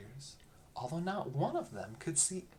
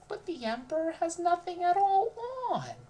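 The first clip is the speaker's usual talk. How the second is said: put-on much higher, louder child voice; little child; 10 dB louder than usual. A narrator's reading. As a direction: blurt it out like a small child. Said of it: "said a little child".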